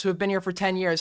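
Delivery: usual speaking voice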